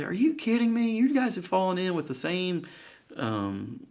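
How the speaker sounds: looking down on the crowd; indignant high snooty voice; scornful Pharisees. Retold or imitated. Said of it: "they react and they say".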